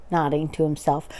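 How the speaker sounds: ordinary delivery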